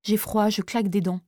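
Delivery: usual speaking voice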